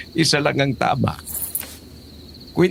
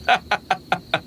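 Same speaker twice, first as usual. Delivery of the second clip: a high, cheerful laugh at his own joke; hearty laugh; 8 semitones higher than usual. Acted. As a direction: laugh off the teasing like a playful grandpa. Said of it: "Sabay kaming napatawa sa sinabi niya".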